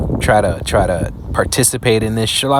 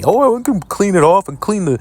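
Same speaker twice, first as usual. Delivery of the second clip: higher, mock-casual voice of the man; a lightly voiced dismissive imitation; 6 semitones higher than usual. Mocking the man cleaning moldy meat, light mock voice. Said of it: "This guy's gonna speak vanity and tell you".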